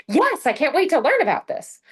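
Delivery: pretend-thrilled customer, a bit overdone; mock eager. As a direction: ham up a chirpy keen voice.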